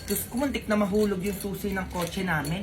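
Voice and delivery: put-on low, soft voice of a rich old lady; rich old woman. Rich old Mars brags to the mother in a low voice.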